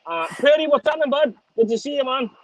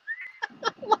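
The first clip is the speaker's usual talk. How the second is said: high cackle; 7 dB quieter than usual. Real, not acted. Laughing at his own rant.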